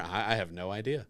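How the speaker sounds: ordinary talk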